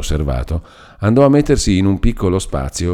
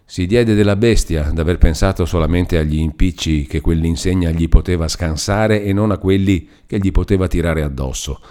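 ordinary delivery

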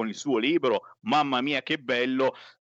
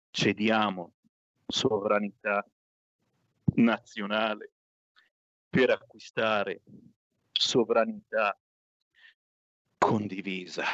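pained, halting reading; 3 semitones lower than usual. Acted. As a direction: drag each word out reluctantly. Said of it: "Non ce la faccio".

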